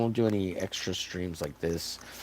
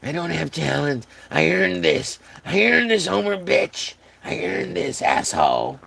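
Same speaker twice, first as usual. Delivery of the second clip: mock indignant friend; 6 semitones higher than usual. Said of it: "He's like".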